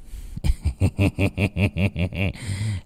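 villain laughing at her fear; lecherous laugh. Acted. (tiếng cười dâm đãng)

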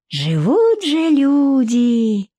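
longing, sighed admiration; dreamy sigh. A narrator's reading. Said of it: "мечтательно вздохнула баба Зина".

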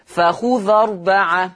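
فخذ أربعة with the ذال of فخذ opened and carried slowly onto أربعة; open dhal. Shown as a demonstration. Teacher showing students how the dhal takes the fatha. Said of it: "شوفوا فتحت الذال إزاي؟".

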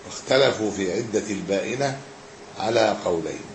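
measured scholarly reading with long pauses; formal text reading. Acted. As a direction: read it out phrase by phrase, letting endings drop. Reads the book aloud.

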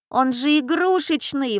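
put-on high-pitched girlish protest; indignant. A narrator's reading. Катя finds the pistol is a toy, indignant. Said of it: "возмутилась Катя".

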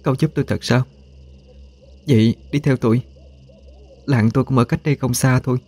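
high, soft female character voice; pleading woman. A narrator's reading. Ghost woman lures Minh to her village, high and soft.

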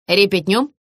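ordinary delivery

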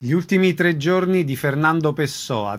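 usual speaking voice

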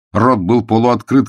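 plain reading voice

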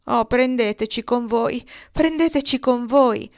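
high, loud plea, building on the repeat; pleading call. A narrator's reading. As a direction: beg loudly to be taken along. Factory girls beg to come along.

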